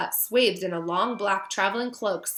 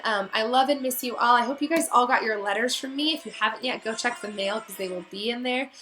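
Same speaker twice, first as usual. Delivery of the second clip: ordinary delivery, nothing marked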